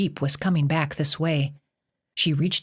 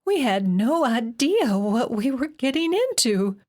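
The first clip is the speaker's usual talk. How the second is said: tearful; 7 semitones higher than usual. A narrator's reading. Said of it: "Sharon's voice cracked as she broke into tears".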